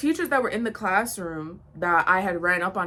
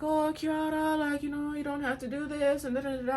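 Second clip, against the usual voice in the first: higher, softer, a pleading whine; mock meek girl voice; 6 semitones higher, 4 dB quieter than usual. Mocking the girl's timid plea to the narrator. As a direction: imitate her timid excuse lightly.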